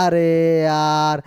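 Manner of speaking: very slow, low and held on one note; long drawn-out exclamation. Real, not acted. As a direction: stretch each word right out. Drawn-out filler while working problem. (नीची आवाज़ में लंबा खींचकर)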